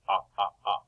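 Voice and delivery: ah, ah, ah as an open short vowel; short o sound. Shown as a demonstration. Teacher modelling short o to children, open ah. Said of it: "It's a short O".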